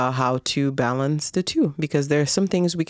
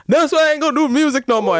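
mock crying rapper; 9 semitones higher, 4 dB louder than usual. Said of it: "got on live online to your followers".

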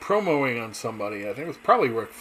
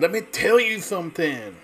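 a hint of Dusty Rhodes, higher and louder; lightly voiced wrestling promo opener; Dusty Rhodes catchphrase; 6 semitones higher, 5 dB louder than usual. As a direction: toss off the wrestler's signature line. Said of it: "he always said".

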